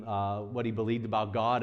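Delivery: usual speaking voice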